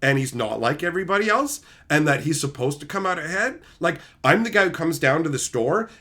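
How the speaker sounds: normal talking voice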